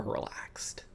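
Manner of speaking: soft unvoiced whisper. Shown as a demonstration. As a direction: breathe the words out. Whispered word relaxed.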